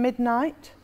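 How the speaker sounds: normal talking voice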